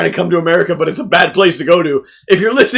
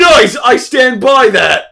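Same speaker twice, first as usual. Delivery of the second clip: slow smug approval; 7 semitones higher, 6 dB louder, much slower than usual. Retold or imitated. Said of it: "I'm like".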